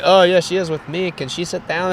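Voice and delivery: lightly put-on Mexican accent; light mock Mexican-accented English; imitated Mexican man. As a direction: retell it with a hint of a Mexican accent. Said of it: "overweight Mexican guy".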